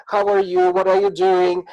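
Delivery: pushy caller. Retold or imitated. Overfriendly lady phoning the family daily, eager high greeting.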